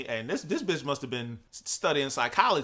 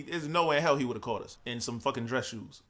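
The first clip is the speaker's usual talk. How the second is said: usual speaking voice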